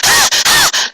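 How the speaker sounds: very high wicked cackle; evil laugh. Acted. Evil Mercury cackling after the hit, high cackle.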